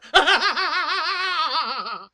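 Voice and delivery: loud, high villain cackle; evil laugh. Acted. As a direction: cackle like a mad baron. Villain laugh after poem.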